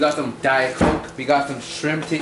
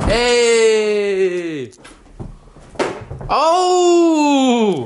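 excited shout; 7 semitones higher, 4 dB louder than usual. Real, not acted. Playing ball with the dog.

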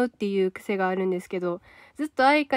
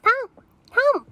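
trying Tantan's voice; 12 semitones higher, 4 dB louder than usual. Retold or imitated. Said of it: "タンタンの声ってちょっとな".

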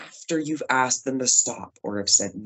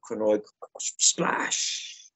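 whispered splash; 5 semitones lower, 6 dB quieter, much slower than usual. Pretend. Playful word for diving in.

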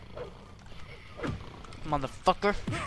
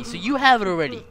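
usual speaking voice

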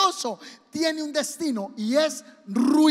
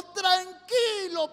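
calma exagerada; 7 semitones higher, much slower than usual. Acted. Holgazán habla muy calmado.

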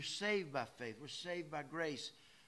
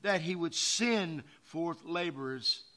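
preaching scripture; 8 dB louder than usual. Acted. Preacher reading the harvest verse to his church, loud proclaiming. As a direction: proclaim the call for laborers.

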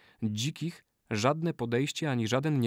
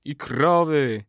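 firm, louder answering voice; ritual response; 3 semitones higher, 5 dB louder, slower than usual. A narrator's reading. Brothers echo the old man's oath, solemn response. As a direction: swear it back firmly.

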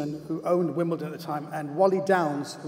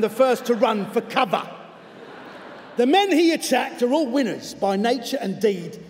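slightly cockney letter reading, emphatic; 3 semitones higher than usual. Acted. Football coach denouncing a TV presenter by letter, in a cockney accent. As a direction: read it out as an indignant cockney. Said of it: "I'm gonna do it in his voice".

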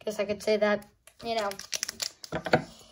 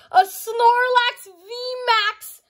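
excited reveal; 10 semitones higher, 5 dB louder, much slower than usual. Real, not acted. Reveals rare card excitedly.

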